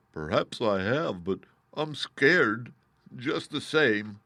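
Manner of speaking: slow, drawn-out scared lion voice; a big cat admitting fear, slowly; timid Lion. Acted. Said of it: "said the Lion".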